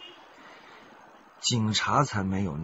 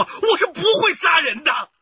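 high, loud cry of desperation; anguished shout; 10 dB louder than usual. Acted. Killer confesses to investigators, shouting in anguish. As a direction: blurt it out at the top of the voice.

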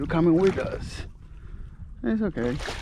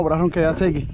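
ordinary talk, nothing marked

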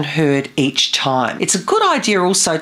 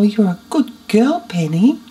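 doting pet talk; mothering voice; 8 semitones higher than usual. Shown as a demonstration. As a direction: coo at a good pet. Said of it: "use this as a mothering voice".

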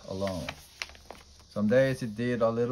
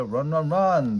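loud, higher team scream; 5 semitones higher, 6 dB louder than usual. A narrator's reading. Teammates screaming at Bobby to run, loud. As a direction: scream them toward first base. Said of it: "the Bobcats screamed".

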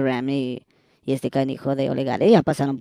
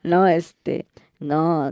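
usual speaking voice

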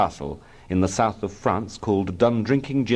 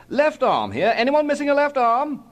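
calling out. A narrator's reading. Policeman father seeks owner of left arm, calling out. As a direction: call round the street, rising on the end.

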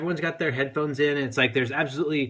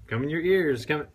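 ordinary talk

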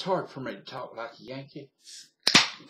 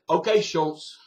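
New York guy; 2 semitones higher, 8 dB louder than usual. Retold or imitated. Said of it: "a New York guy said".

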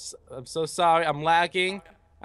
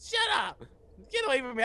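high, squeaky pretend protest; mock scared protest; 7 semitones higher, 4 dB quieter, faster than usual. Player fleeing the candy man, mock scared protest. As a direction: push them away in a high, hammed-up voice.

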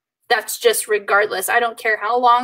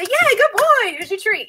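high, bright praise for the dog; happy dog praise; 11 semitones higher than usual. Retold or imitated. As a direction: cheer the dog on. Praising dog after sit.

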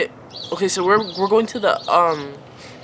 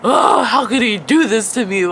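half-laughing, half-sobbing wail, a bit louder and higher; mock crying scream; 4 semitones higher, 5 dB louder than usual. Upset after the call, she imitates herself wailing through laughs. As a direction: ham up a tearful cry of betrayal, laughing through it. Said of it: "like, screaming".

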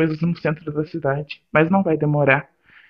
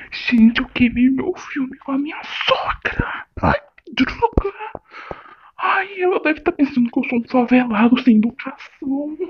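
higher, whining lament; whiny despair; 6 semitones higher than usual. Acted. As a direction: moan over the blunder, near tears. Desespero após ofender a sogra. (choramingando desesperado)

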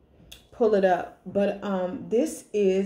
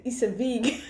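put-on African accent; 3 semitones higher than usual. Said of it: "And she said".

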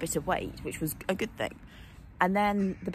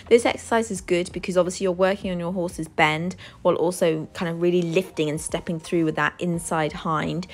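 louder, bright presenter voice; presenting to camera; 9 dB louder than usual. Acted. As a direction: project like a tutorial host. Presents new kit and exercises.

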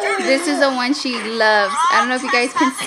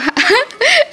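embarrassed laugh; 12 semitones higher, 5 dB louder than usual. Real, not acted. Laughing at being teased.

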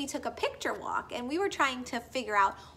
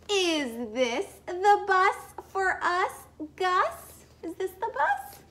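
slow, higher and louder refrain; a child hoping the bus has come; eager child's question; 6 semitones higher, 5 dB louder, slower than usual. Acted. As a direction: ask hopefully, stretching it out.

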